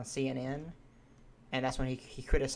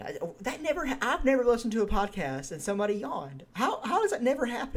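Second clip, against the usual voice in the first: amazed; 10 semitones higher than usual. Real, not acted. Amazed nobody else yawns on podcasts.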